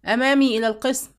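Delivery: a narrator's firm shout; shouted order. A narrator's reading. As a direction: shout him to the station. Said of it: "فصاح".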